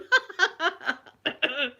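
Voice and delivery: high, loud imitated laughing fit; uncontrollable laughter. Me failing to stop at the funeral, laughing loudly. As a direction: burst out laughing despite yourself. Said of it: "the more I was going".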